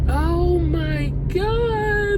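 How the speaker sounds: high, tearful voice breaking into whisper; awed crying. Real, not acted. Driver watches the rainbow getting closer, crying in awe. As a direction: weep in amazement, drawing out the exclamations. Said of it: "that's making me cry".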